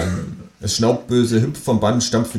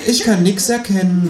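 murmured; 11 semitones higher than usual. A narrator's reading. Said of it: "murmelt die Frau".

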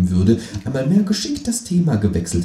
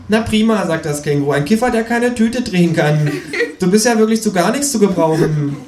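high kangaroo voice, loud and laughing; mock the useless stoner; scornful kangaroo; 11 semitones higher, 4 dB louder than usual. (höhnische, hohe Känguru-Stimme)

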